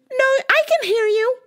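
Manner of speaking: high squeaky mock of a stubborn customer. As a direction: mimic her like she's a whiny brat. Customer refusing through window.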